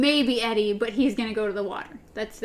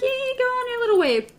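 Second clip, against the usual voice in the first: high mocking voice; 12 semitones higher than usual. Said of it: "It's like".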